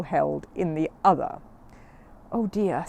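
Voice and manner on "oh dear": ordinary delivery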